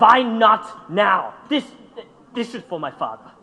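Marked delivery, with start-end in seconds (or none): 0.0-1.3 louder and drawn out slowly, every word weighted; higher, slow, pressing the question home; slow defiant challenge. Acted. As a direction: dare him to say no. Defiant challenge to his partner.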